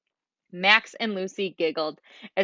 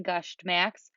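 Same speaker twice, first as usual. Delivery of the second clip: ordinary delivery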